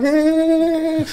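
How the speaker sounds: a hammed-up yelp of dismay; short high comic squeal; squeaky dismayed eh. Retold reaction to the heavy bag.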